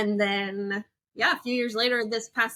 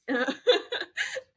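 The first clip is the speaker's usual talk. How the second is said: short rising giggle; light embarrassed laugh; 9 semitones higher than usual. Real, not acted. Guest laughing as she admits they met on a dating app, short giggle. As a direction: laugh it off, a bit sheepish.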